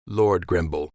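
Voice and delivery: ordinary delivery, nothing marked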